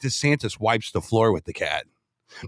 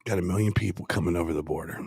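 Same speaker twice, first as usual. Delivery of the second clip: low, soft, menacing delivery; tough meat voice; 9 semitones lower, 6 dB quieter, faster than usual. Shown as a demonstration. Coaching the candidate for the camera, low tough-guy demo. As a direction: drop it down low like a hard man. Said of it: "just look in the camera and go like this".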